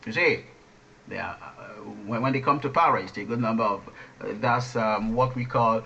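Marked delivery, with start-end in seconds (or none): none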